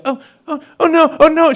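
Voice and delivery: high-pitched play-acted alarm; pretend fright. Girlfriend playing captive in a game, mock-frightened. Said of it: "get into character".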